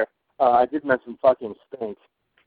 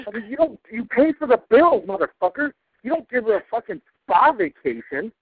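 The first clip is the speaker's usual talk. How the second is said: higher, louder furious tirade; angry rant; 10 semitones higher, 5 dB louder than usual. Real, not acted. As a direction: let the anger boil over. Ranting about the weather guy.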